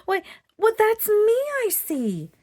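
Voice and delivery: high, loud delighted cry; excited. Acted. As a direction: burst out with joy at the discovery. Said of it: "she became very excited".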